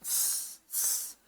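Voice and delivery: long sustained hissing स-; teacher showing a prolonged स-; demonstrated S sound. Shown as a demonstration. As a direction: draw out स- slowly. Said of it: "आप ये आवाज निकालने के लिए".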